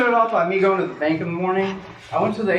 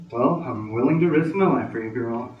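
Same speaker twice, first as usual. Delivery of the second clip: smooth flirt; 7 semitones lower than usual. Retold or imitated. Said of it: "I was like".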